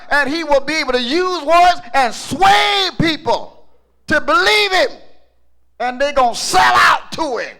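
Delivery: loud, high shouted sermon; full-force preaching. Acted. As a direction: hammer it out to the congregation.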